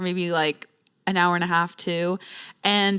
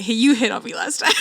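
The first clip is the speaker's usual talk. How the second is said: giggling accusation; laughing tease; 2 semitones higher than usual. Pretend. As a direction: tease him through a laugh. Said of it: "teasing confrontation, laughing".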